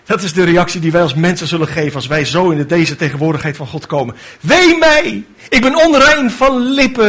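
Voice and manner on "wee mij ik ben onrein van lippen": high anguished cry. Retold or imitated. Isaiah before God's throne, crying out high. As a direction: cry out in dismay. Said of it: "riep hij uit".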